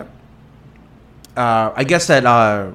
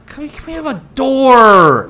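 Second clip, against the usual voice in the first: mock plea; 10 semitones higher, 5 dB louder than usual. Asking dentist staff for a door.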